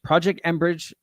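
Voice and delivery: usual speaking voice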